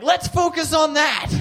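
high, loud, urgent insistence; alarmed shout. Retold or imitated. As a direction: yell it in acted disbelief. Acts out alarmed reply.

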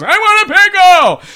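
high, loud demand; hammed-up old-man tantrum; whining old grandpa. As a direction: holler it like a stubborn grandpa. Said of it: "He's like".

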